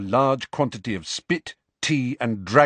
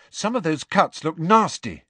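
put-on higher-pitched worried girl; anxious concern; 8 semitones higher, 3 dB louder than usual. A narrator's reading. Hermione frets over Hagrid's wounds, anxious. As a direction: fret over a friend's injuries.

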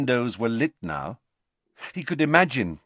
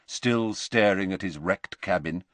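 usual speaking voice

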